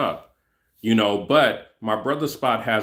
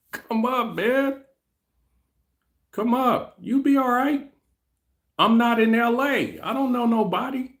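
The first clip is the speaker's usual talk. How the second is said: high, squeaky put-on voice; laughing, high-pitched caricature of the other guy; mocking voice; 11 semitones higher than usual. High put-on voice answering himself.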